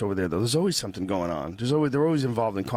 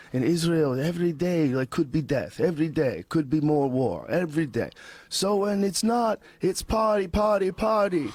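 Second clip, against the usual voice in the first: bad Israeli accent climbing higher into the partying; terrible Israeli accent; 5 semitones higher than usual. Israeli friend explaining war and partying, in a terrible Israeli accent. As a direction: put on a clumsy Israeli accent, building in excitement. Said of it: "I'm doing a terrible accent".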